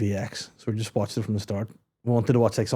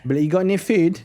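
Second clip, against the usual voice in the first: put-on London street accent, higher; mimicked Top Boy accent; 6 semitones higher, 3 dB louder than usual. Walking round the house talking to partner, mimicked street accent. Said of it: "in that accent".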